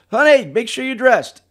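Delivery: high, loud call to someone in another room; retold yell. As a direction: imitate a husband hollering to his wife. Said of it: "And he yells".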